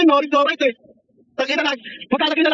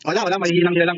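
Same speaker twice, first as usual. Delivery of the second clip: low dismissive taunt; 7 semitones lower than usual. Real, not acted. Streamer dismissing weak trolls, low and scornful. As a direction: drop low, sneer it out.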